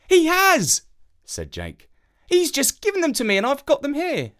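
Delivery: put-on high boy's voice; a boy telling the crow about his dad, in a high child's voice; young boy. A narrator's reading. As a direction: speak like a small boy explaining.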